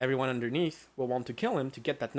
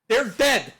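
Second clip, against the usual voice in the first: "They're dead" louder and higher, falling hard on "dead"; loud exasperated insistence; 6 semitones higher, 7 dB louder than usual. Real, not acted. Shutting down friends arguing about wounds, loud and exasperated. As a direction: shout it down to end the argument. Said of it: "repeats "They're dead" again, loud".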